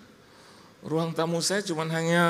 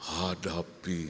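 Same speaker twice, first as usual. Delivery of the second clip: low, slow, softer pious mother; God's-sibling solemnity; 9 semitones lower, 5 dB quieter, much slower than usual. Retold or imitated. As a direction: imitate a mother answering with divine gravity. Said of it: "kayak saudaranya Tuhan gitu loh". (ibu sok ilahi, rendah dan pelan)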